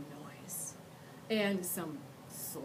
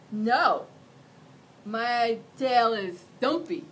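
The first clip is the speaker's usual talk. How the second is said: slurping; 3 semitones higher, 10 dB louder, slower than usual. Acted. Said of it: "Mac slurped".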